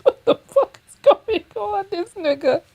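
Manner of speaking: high-pitched, baffled disbelief; real high-voiced incredulity; incredulous outburst. Real, not acted. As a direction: blurt it out high, totally baffled. Baffled reaction to played clip.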